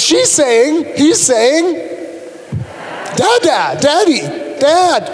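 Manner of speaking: put-on a proud dad calling out his baby's first word; excited new father; thrilled parent. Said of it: "rushes into the room ... picks up the baby, and says".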